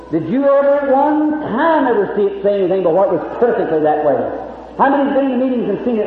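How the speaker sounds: ordinary talk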